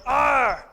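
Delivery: loud gruff sailor growl; hammed-up pirate-like arr; Popeye growl. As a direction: bark it out like a cartoon sailor.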